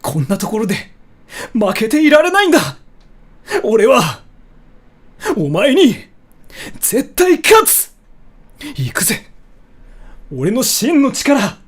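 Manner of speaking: fired-up anime hero, high and loud; shonen protagonist voice. Acted. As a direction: declare it like a hot-blooded hero. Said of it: "Sora, can you do a shonen protagonist voice?".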